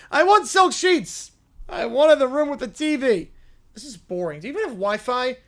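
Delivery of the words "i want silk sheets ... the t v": high, loud, demanding princess; spoiled little princess. Acted. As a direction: act like a spoiled tiny princess who is owed everything.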